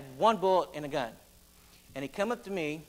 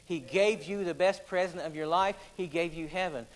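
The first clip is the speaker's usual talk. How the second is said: ordinary delivery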